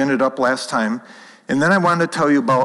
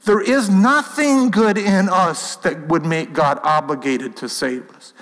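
ordinary talk, nothing marked

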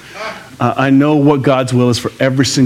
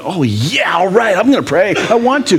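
rising, higher and higher, keen to pray; eager willing yes; 7 semitones higher than usual. Pretend. A believer happily agreeing to pray, eager and rising. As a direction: sound like you actually want to pray. A prayer said willingly, not as duty.

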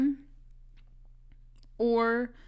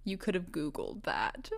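mock whisper, unimpressed; whispered deadpan put-down; whispered aside; 3 semitones lower, 6 dB quieter than usual. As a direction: whisper it like a bored friend. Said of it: "They're like".

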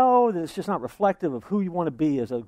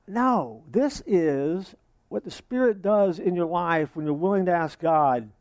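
ordinary talk, nothing marked

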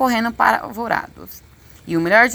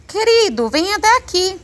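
high-pitched call to a husband; mother calling out, voice way up; gritando; 12 semitones higher, 3 dB louder than usual. A narrator's reading. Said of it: "ela começa a gritar". (chamado agudo, gritado)

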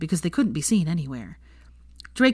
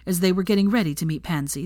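ordinary delivery, nothing marked

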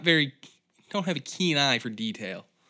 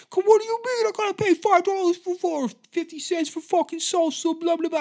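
very high, loud ranting customer voice; mock angry yelling; 7 dB louder than usual. Burrito customer yelling at the worker, high mock rant. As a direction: caricature a squawking complainer, way up in pitch. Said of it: "he was yelling at the worker".

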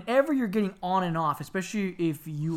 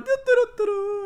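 put-on high-pitched toddler babble; imitated little kid; 4 dB louder than usual. Brother little at Disney World, imitated childish babble. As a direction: pipe away like a carefree tot. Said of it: "my brother's a little like".

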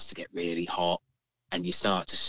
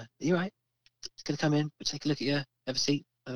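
lightly voiced nurse, higher at first; 3 semitones higher, much faster than usual. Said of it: "the nurse comes over".

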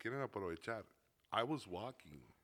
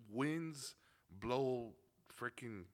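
ordinary talk, nothing marked

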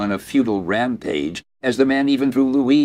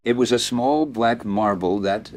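ordinary delivery

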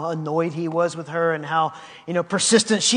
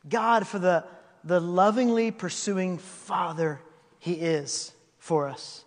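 ordinary delivery

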